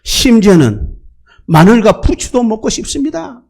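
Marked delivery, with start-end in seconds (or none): 1.5-3.4 higher, pleading grumble for garlic and leeks; whiny craving. Retold or imitated. Preacher mimicking grumbling Israelites, mock whine. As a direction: whine it like a spoiled complainer. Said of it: "원망하는 거예요".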